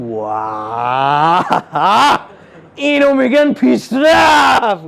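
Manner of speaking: put-on scoffing old lady voice, drawn out then shouted; hammed-up mother-in-law sneering at the car; mocking mother-in-law. Mother-in-law sneering at his new car, mock shout.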